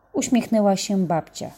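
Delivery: ordinary delivery, nothing marked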